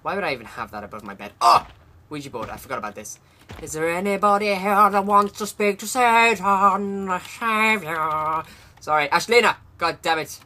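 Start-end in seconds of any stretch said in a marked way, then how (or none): 3.6-8.4 slow, ominous summoning; hammed-up cult leader; spooky invocation; mock séance invocation.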